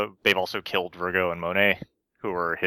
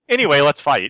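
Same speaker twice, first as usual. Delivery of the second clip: loud, higher mock brush-off; abrupt topic change; 6 semitones higher, 8 dB louder than usual. Characters dodging Law's backstory, loud mock brush-off. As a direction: cut in loudly, imitating a hasty change of subject.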